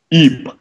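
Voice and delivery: short startled squeak; squeaked cry. Acted. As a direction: yelp in fright at the blast. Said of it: "cried Fluttershy".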